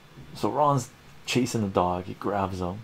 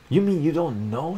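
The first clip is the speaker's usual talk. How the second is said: mock yell; 8 semitones higher than usual. Wife snaps at her clueless husband, mild mock yell. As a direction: snap back like an annoyed wife. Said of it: "she yells".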